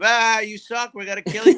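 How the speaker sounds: half-yelled group taunt; retold taunting yell. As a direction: heckle rival fans, playing it back. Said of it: "we started yelling at them".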